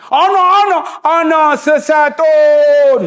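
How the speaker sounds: high, loud, drawn-out slow plea; lost uncle pleading in broken Arabic; pleading lost pilgrim. Retold or imitated. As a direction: stretch out the words, helpless. Lost man pleading to policeman.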